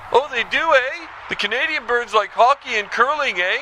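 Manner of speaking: hammed-up Canadian caller tacking on eh; Canadian accent.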